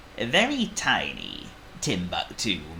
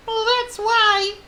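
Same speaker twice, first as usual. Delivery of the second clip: very high, loud wailing tiny mouse; Squeak; 7 dB louder, slower than usual. A narrator's reading. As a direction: squeal it as a tearful little mouse. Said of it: "said Squeak".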